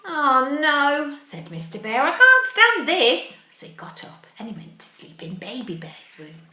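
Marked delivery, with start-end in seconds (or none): 0.1-1.2 loud, high cry of dismay; exasperated Mister Bear. Acted. Fed-up bear exclaims in story. 2.0-3.4 loud, high, slow storybook complaint; exasperated Mister Bear. Acted. Fed-up bear exclaims in story.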